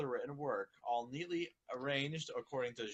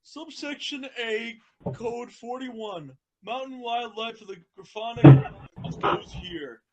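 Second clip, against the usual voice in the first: Spike voice; 7 semitones higher, 10 dB louder than usual. Acted. Reading Spike's lines aloud to the group, in a lightly voiced dragon tone. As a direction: read it as young Spike. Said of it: "I will be playing the role of Spike".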